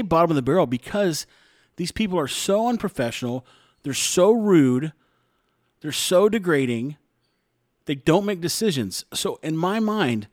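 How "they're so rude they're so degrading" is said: slow, drawn-out "so" on each complaint; stressed "so". Real, not acted. Listing the TSA staff's faults, with a slow stressed "so". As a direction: lean hard on "so" every time.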